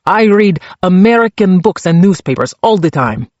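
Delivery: put-on Czech-accented prisoner boasting of his English; thick Czech accent. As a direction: brag in a heavy Czech accent. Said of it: "his accent so thick".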